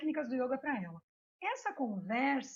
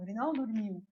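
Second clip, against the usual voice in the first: ordinary talk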